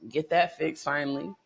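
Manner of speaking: normal talking voice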